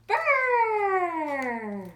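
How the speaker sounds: loud high teeth-chattering brrr; shivering brrr. Acted. Reader shivering like a polar bear in snow, high loud brrr.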